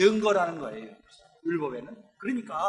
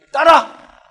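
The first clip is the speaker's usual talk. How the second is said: sudden loud high call; acted shout; 7 semitones higher, 15 dB louder, much slower than usual. Preacher voicing Jesus calling to the woman, shouting. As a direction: call out to her across the crowd.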